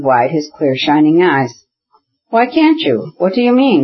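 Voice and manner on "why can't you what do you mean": high, puzzled question; baffled, asking high and bright; perplexed. A narrator's reading. Stepan puzzled by his brother-in-law's refusal, perplexed high voice. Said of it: "he asked in perplexity".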